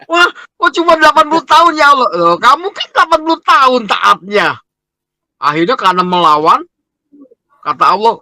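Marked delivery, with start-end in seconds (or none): 0.1-4.5 high-pitched mock protest; acted indignation. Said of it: "Marah dia". (protes marah tiruan bernada tinggi)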